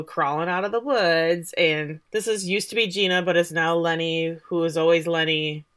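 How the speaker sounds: usual speaking voice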